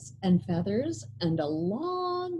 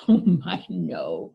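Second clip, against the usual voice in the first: amused denial; 3 semitones lower, 6 dB louder than usual. A narrator's reading. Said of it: "replied the old woman".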